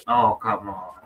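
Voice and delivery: low, soft, fed-up sigh of oh come on; mock despair, low and deflated; exasperated groan. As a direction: groan it like you've given up. Exasperated at being rejected.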